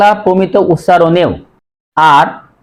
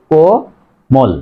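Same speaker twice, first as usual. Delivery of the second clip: Komal stretched very slowly, syllable by syllable; slow drawn-out word; much slower than usual. Shown as a demonstration. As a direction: draw out Komal as a model. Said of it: "তাহলে কয়কার, ময়কার ল হস". (কোমল ধীরে টেনে উচ্চারণ)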